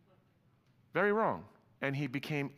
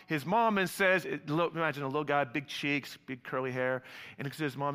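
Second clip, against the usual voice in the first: ordinary talk, nothing marked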